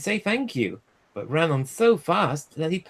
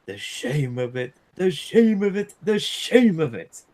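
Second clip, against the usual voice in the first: grumbling; 3 semitones higher, 3 dB louder than usual. A narrator's reading. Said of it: "he grumbled".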